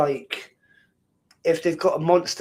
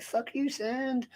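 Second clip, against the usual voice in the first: put-on higher, softer, slow little-girl voice; little girl's voice; 8 semitones higher, 8 dB quieter, slower than usual. Dad imitating his daughter swearing at the sand, higher, softer and slow. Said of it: "And with her little voice".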